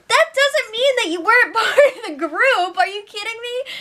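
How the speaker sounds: high-pitched, laughing indignation; can't believe it, laugh through it; laughing disbelief. Real, not acted. Incredulous at the brothers' excuse.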